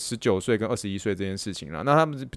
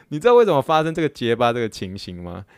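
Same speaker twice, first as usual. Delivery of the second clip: ordinary talk, nothing marked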